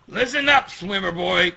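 high, loud challenge; confrontational threat. Acted. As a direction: warn off the swimmer boy. Acted threat to a rival in story.

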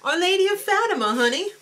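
high, sassy flourish on Fatima. Pretend. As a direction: camp it up, honey. Campy flourish on name.

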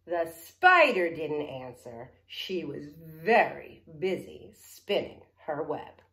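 slow, loud, deliberate refrain; story reading to children. Acted. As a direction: draw out the storybook refrain for kids.

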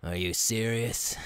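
ordinary talk, nothing marked

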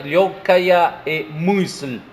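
лёгкая and мысль spelled out very slowly; slow pronunciation. Shown as a demonstration. Teacher breaking the word into its roots, very slow. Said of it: "Da лёгка".

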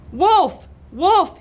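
loud, high-pitched warning shout; alarm cry. A narrator's reading. Cat scaring birds off eggs, urgent cry. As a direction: raise the alarm with each word. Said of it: "he cried".

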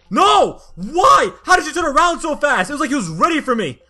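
loud, high protest; indignant outburst. Real, not acted. Gamer shouting at an enemy who turned too fast, indignant.

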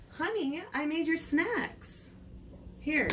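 high, soft, slow mom voice; a sweet mother bringing snacks. Acted.

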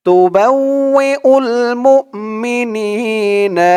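slow, high melodic tilawat; Quran recitation. Shown as a demonstration. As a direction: sing out the verse like a qari. (উঁচু সুরে ধীর কুরআন তিলাওয়াত)

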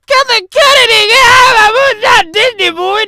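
loud shouted order, high and strained; crying shout of get out; yelling to get out. Acted. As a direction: yell him out of the room. Yelling at someone to leave.

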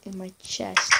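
normal talking voice

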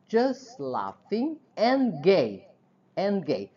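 ordinary talk

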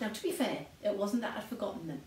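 normal talking voice

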